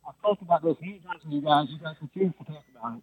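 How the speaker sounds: talking into his hand; muffled phone. Real, not acted. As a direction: hand over the phone mouthpiece. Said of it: "you're kinda muffling your phone".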